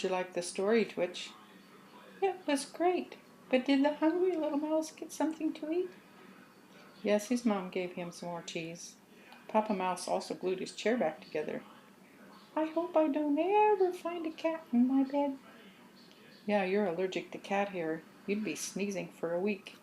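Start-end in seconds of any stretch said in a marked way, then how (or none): 2.2-5.9 higher, quick and eager squeak; a little mouse asking eagerly; high child mouse voice. Acted. Twitch asks about the hungry mouse, high eager squeak. 12.5-15.4 higher, quick and eager squeak; a little mouse asking eagerly; high child mouse voice. Acted. Twitch asks about the hungry mouse, high eager squeak.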